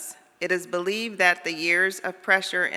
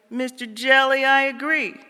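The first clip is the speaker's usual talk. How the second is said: higher, louder lift; fish agreeing; 7 semitones higher, 5 dB louder than usual. Acted. Fish answering the jellyfish, raised and bright. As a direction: concede brightly.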